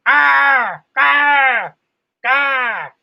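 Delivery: loud, high crow squawks; imitated crow caw. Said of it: "You'd hear that crow in the bird cage going".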